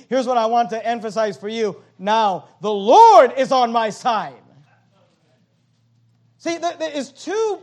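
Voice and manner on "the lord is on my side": loud ringing declaration; preaching at full force. Acted. Pastor booming the verse to the church.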